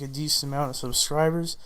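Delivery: ordinary delivery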